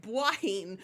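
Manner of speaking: ordinary delivery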